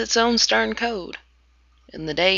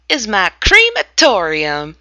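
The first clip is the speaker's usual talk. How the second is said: sudden cry; 8 dB louder than usual. Acted. Narrator spotting the derelict boat, sudden loud cry. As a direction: blurt it out as the idea strikes. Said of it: "with a sudden cry".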